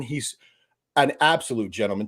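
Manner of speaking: ordinary talk, nothing marked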